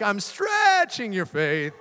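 slow, loud, booming God's voice; God answering the pastor, slow and loud; God's reply. Pretend. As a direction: boom it out slowly as God. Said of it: "And he's like".

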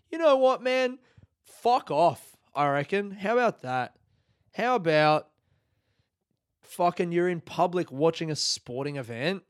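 worked-up comic comeback; indignant rant. Acted. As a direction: tell them off, loudly exasperated. Acted rant back at the women.

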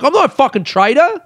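indignant denial; retold angry retort. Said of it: "I'm like".

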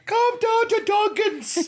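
high, slow, hammed-up Irish lilt; leprechaun-style Irish voice, way up high; mock Irish accent. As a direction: sing it like a cheesy Irish ad. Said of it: "that's not an Irish accent".

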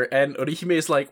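usual speaking voice

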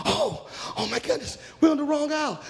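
high-pitched flustered realisation; acted alarm; alarmed girls. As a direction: exclaim it in dismay, voice jumping up. Girls realise they are lost.

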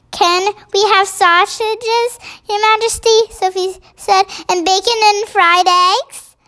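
put-on a hungry child hoping for breakfast; little girl asking.

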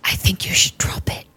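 hushed unvoiced whisper; stealth whisper. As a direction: whisper it so the guards miss it. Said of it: "stealth whisper".

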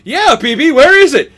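loud, high mock questioning; hammed-up impatient shout; imitated urgent demand. Acting out film character's urgent question.

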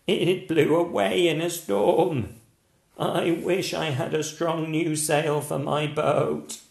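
a bleating boat-owner goat. Acted. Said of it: "said the goat".

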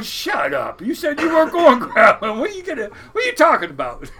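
high, loud and laughing; hunting buddies mocking him for going crabbing after all, laughing; laughing disbelief.

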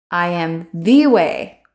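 the leaned on, slow; "the" stressed, slow and falling. Shown as a demonstration. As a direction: give weight to the. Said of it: "emphasize specific words".

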